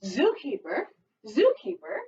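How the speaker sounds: put-on sing-song read-aloud for little kids; storytime reading. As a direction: read it like a lively picture book. Teacher reads picture book aloud.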